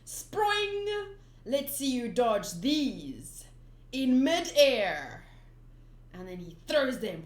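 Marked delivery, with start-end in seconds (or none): 0.0-5.2 slow loud gloating call; taunting villain. Acted. Buggy gloating mid-attack, loud and slow. Reading manga fight.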